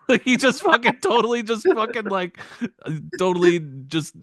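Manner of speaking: words break into high, loud laughter; can't get the words out for laughing. Real, not acted. Laughing while describing referee.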